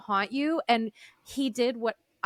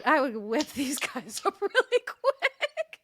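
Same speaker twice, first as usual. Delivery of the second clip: laughing; 4 semitones higher than usual. Real, not acted. Laughing while joking about coaching.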